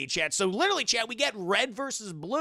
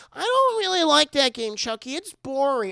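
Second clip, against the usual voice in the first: high, loud mock-kid complaint; whining child; 7 semitones higher, 9 dB louder than usual. Tyler refusing Chucky's game, whining child. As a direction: play a boy objecting to a game.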